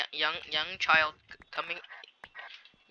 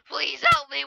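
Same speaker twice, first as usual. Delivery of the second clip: higher and louder, quick cry for help; quick plea; 9 semitones higher, 3 dB louder than usual. Real, not acted. Player begging mid-fight, quick higher cry. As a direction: fire it out fast.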